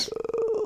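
whispered, high bored groan. Retold or imitated. Cohost bored on air, whispered high groan. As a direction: groan like you have nothing to say.

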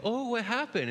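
high, sing-song "what happened?"; playing dumb with the child; mock innocent parent. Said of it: "I go".

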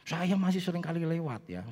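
usual speaking voice

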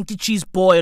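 usual speaking voice

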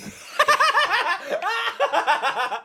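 a burst of high, squealing laughter. Real, not acted. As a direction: crack up high and hard. Laughing at own story.

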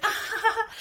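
high-pitched mock laugh; retold laugh. Telling how she was joking at the bar, high mock laugh. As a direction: re-enact a burst of laughter up high. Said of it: "I was, like, laughing about something".

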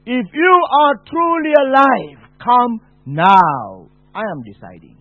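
loud, high defiant challenge; furious demand to be shown; angry shout. Retold or imitated. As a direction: hurl it at heaven in rage. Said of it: "I shouted and said".

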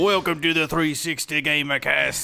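hammed-up accent drifting from British; dubious British accent. Shown as a demonstration. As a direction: announce it in a wobbly fake British accent. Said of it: "not sure if this is British".

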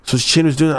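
ordinary delivery

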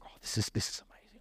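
breathless whispered wonder; awed whisper. Acted. God delighting in his treasure, whispered awe. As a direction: hush it like you can't believe it.

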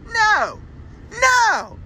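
shrill outraged no. Real, not acted. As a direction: squeal the no in disbelief. Outraged at kids' pancakes.

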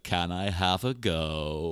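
slow, drawn-out drag queen impression; imitating a drag queen's languid drawl; Alaska. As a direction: stretch every word out lazily. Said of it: "Just in that typical voice".

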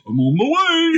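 very high, eager mock reply; high-pitched keen response; eager actor. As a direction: answer the call in a comic high voice. Acts gorilla actor eagerly answering.

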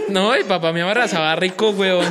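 laughing hard while telling the joke. Real, not acted. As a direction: giggle the words out. Joking about the father's hugs.

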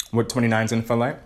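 ordinary delivery

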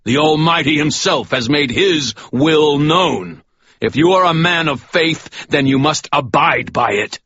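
booming righteous rebuke; stern sermon. Acted. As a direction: lay down the truth to the fallen man. Michael rebukes the priest.

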